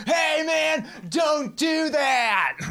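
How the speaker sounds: very high, stretched-out mock shout; retold all-out yell. Lifeguard standing up to yell at a deaf kid, drawn-out shout. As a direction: holler it slowly, dragging every word. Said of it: "I just have to yell".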